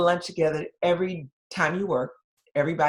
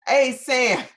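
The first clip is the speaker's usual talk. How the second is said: confronting; 8 semitones higher, 8 dB louder than usual. Retold or imitated. Charge nurse confronts the nurse.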